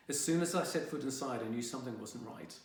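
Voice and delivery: ordinary delivery, nothing marked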